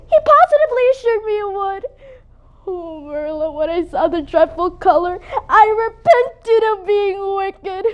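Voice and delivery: tearful, halting, slowing down; crying confession. Acted. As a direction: weep while owning up. Confessing the dye through tears.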